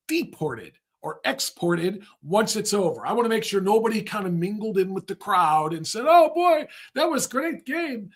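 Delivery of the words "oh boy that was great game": high, put-on foreign accent, vaguely Irish; unplaceable foreign accent. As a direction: mimic a foreign soccer fan in a muddled accent. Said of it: "I don't know what accent that was".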